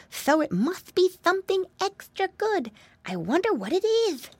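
high-pitched little pig voice; wondering piggy boy. Acted. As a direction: puzzle it out aloud as a piglet. Voices Flop wondering aloud.

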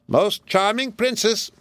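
high, slow squawky parrot. Acted. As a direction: chirp it like a talking bird. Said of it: "assumed the tone of a parrot".

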